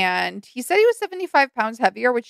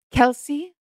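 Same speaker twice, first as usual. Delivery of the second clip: dreamy mom voice. Retold or imitated. Mom voiced naming her baby.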